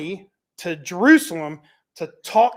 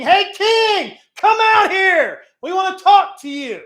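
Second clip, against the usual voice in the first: high, loud shout at the gate; army shouting to the king from outside; yelling; 11 semitones higher, 10 dB louder than usual. Retold or imitated. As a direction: yell up at the walls to call him out. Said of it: "they yell into the king".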